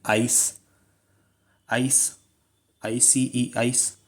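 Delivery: आइस said slowly and softly, ending on a crisp s sound; teacher modelling आइस for the class to repeat; clear pronunciation demo. Shown as a demonstration. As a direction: spell out I C E, then say आइस clearly. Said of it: "देखिए मेरे साथ pronounce कीजिए".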